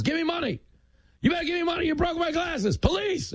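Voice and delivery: very high mock-outraged demand; hammed-up high hollering; street hustler. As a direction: yell it like a pushy scammer. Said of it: "drops his glasses and goes".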